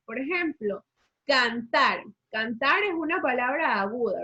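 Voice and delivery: ordinary delivery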